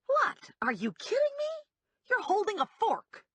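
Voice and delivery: can't believe he's threatening her with a fork; incredulous disbelief. A narrator's reading. Woman facing a man waving a fork, incredulous high outburst.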